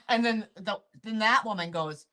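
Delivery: usual speaking voice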